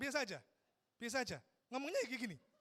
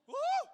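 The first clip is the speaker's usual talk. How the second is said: short, high, loud "ooh"; mock swooning whoop; 11 dB louder than usual. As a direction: yelp in exaggerated delight at a scent. Exclaiming over the perfume.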